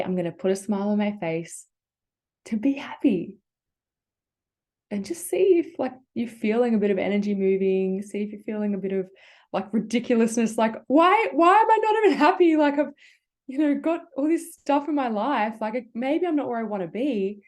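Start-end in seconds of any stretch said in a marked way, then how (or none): 2.5-3.4 whispered. Real, not acted. Smiling softly while guiding. 10.6-14.9 high, loud, exasperated; exasperated outburst. Pretend. Voicing the listener's frustrated thought.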